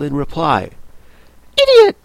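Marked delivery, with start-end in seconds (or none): 1.6-2.0 very high, loud scornful snap; impatient snap. Acted. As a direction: spit it out at a fool. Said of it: "tossing her head impatiently".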